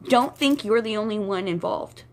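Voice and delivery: normal talking voice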